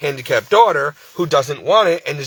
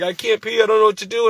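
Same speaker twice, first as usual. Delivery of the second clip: higher-pitched mock distressed plea; imitated crying; 6 semitones higher, faster than usual. Scared patient who can't urinate, retold as crying. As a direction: imitate a helpless man's upset complaint. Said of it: "and he's crying".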